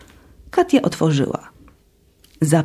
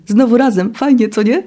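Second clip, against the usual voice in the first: cheerful; 5 semitones higher than usual. Acted. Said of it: "zawołała radośnie".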